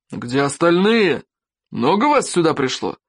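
gruff demand. A narrator's reading. Chieftain questioning the captured boy with an axe, gruff demand. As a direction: stretch out the question like an interrogator. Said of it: "рявкнул Норберт Сумасброд".

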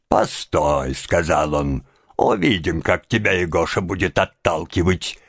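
low, monotone threat from father; understated, low-pitched fatherly warning; lightly voiced stern father. A narrator's reading. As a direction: drop the voice low for an angry father. Angry father's threat voiced in story.

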